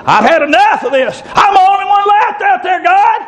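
rising into a high shouted cry; preaching at full force. Acted. Preacher voicing Elijah's despair, rising shouted preaching. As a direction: build it up and cry it out.

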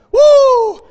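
high joyful woo; excited whoop. Real, not acted. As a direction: shout it for joy. Preacher whoops.

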